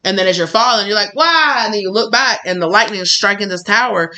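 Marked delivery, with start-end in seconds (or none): 1.2-1.6 mock wail. Falling, asks why.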